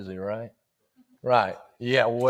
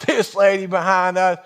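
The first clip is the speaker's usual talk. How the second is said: ordinary talk, nothing marked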